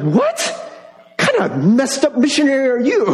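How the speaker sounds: blurting out amused shock at the missionary; laughing disbelief. Retold or imitated. Pastor retelling his reaction to the missionary, laughing disbelief.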